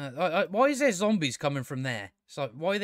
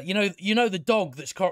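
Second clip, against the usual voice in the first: ordinary talk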